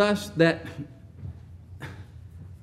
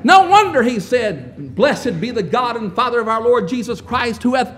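fervent preaching; 3 semitones higher, 5 dB louder than usual. Real, not acted. Preaching at full force.

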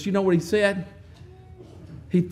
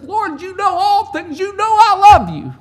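grieved plea; 11 semitones higher, 7 dB louder than usual. Acted. Peter grieved, insisting to the Lord he loves him. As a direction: plead like a hurt friend. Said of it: "He gets grieved with the Lord".

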